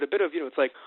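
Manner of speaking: usual speaking voice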